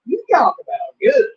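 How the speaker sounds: loud excited praise; excited rave. Real, not acted. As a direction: rave about how good it was. Raving about pork chops.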